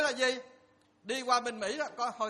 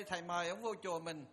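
ordinary talk

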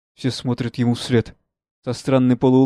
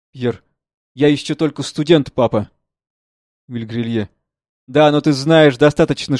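lightly voiced earnest father; 3 semitones higher, 7 dB louder than usual. Father asks son to examine.

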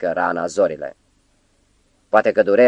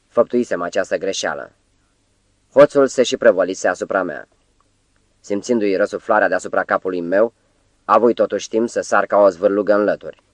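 usual speaking voice

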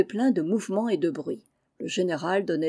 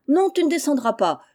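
high, loud acted refusal; firm refusal; 6 semitones higher, 6 dB louder than usual. Laurent refusing to let Félicie get down, raised voice. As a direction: overrule the protest with a raised voice.

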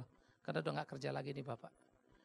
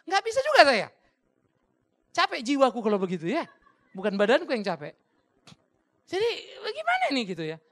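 much higher, louder exasperated cry; exasperated outburst; 6 semitones higher, 12 dB louder than usual. Real, not acted. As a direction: throw up your hands in exasperation. Exasperated confession, very loud and high. (seruan putus asa yang keras dan tinggi)

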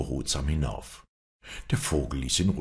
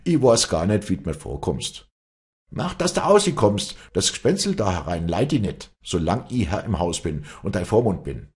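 raised, louder voice throwing him out; head of house turning his brother out, riled; angry brother; 4 semitones higher, 5 dB louder than usual. Acted.

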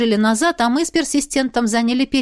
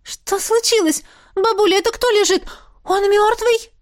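much higher, agitated questioning; 10 semitones higher than usual. A narrator's reading. Granddaughter running out to grandmother, agitated. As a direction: ask anxiously in a high, worried voice. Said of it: "Взволнованная".

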